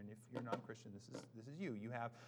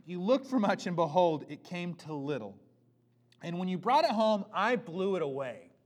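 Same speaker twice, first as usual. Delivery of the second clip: loud, proclaiming reading of the prophet; scripture reading; 5 semitones higher, 13 dB louder than usual. Acted. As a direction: declare God's words to the people.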